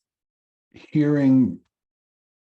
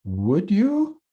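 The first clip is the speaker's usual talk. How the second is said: jumps high in startled disbelief; startled question; 10 semitones higher than usual. Retold or imitated. Grieving patient startled by his doctor's offer, asks in high surprise. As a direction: ask it like you can't believe the offer. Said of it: "he looked at me startled".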